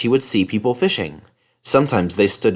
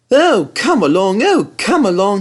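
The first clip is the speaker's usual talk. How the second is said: higher, louder impatient puff; 10 semitones higher, 4 dB louder than usual. Acted. Thomas ignores the coaches, impatient higher puff. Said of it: "he puffed impatiently".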